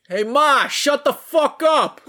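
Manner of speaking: mock Jersey yell to Ma; high, loud, drawn-out Jersey holler; Jersey accent. Shown as a demonstration. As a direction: holler at your mother in hammed-up Jersey. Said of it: "do it in your Jersey accent".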